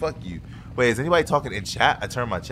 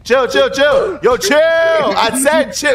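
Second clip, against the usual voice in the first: high, loud mock panic; frantic pleading shout; 11 semitones higher, 5 dB louder, much slower than usual. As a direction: yell at your attacker to stop. Getting beaten up, begging friend to stop.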